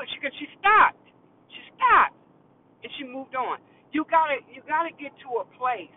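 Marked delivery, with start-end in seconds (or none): 0.0-2.1 high, loud she stopped; triumphant. Real, not acted. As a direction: crow it like a win.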